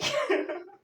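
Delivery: loud high laugh; high burst of laughter. Real, not acted. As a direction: break into laughter.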